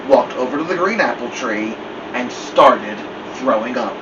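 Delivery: usual speaking voice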